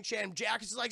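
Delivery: normal talking voice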